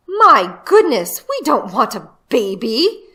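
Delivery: a flustered mother complaining about the noise; annoyed mother. Acted. Mother voiced, alarmed.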